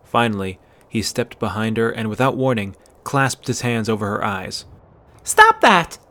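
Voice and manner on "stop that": shrill-pitched loud outburst, much higher. A narrator's reading. Startled girl yells at the boy, high loud outburst. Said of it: "she burst out in a high voice".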